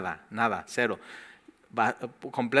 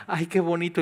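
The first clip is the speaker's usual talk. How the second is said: higher, quicker mock gush; gushing newcomer; 5 semitones higher than usual. Newcomer gushing about the new song, quick mock delight. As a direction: rattle it off like someone charmed by the music.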